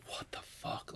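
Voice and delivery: a hushed, shocked what-the-hell; whispered shock at the text; stunned whisper. Retold or imitated. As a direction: breathe it out in disbelief, no voice. Stunned reaction to coach's text.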